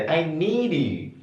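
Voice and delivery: "need" stretched with a long high ee, slowly; slow high demo. Shown as a demonstration. Teacher demonstrating long vowel in a sentence, slow. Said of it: "harus dibaca tinggi".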